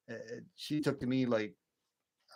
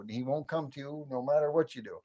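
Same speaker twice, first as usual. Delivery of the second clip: ordinary talk, nothing marked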